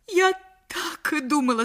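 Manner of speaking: loud, high accusing cry; shout. A narrator's reading. Jealous wife bursting in on her husband, shouting high. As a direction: catch him in the act. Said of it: "закричала она".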